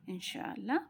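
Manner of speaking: usual speaking voice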